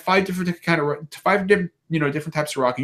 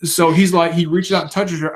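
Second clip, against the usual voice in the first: normal talking voice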